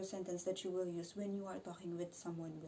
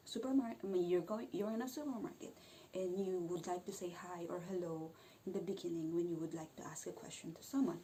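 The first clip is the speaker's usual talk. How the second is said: usual speaking voice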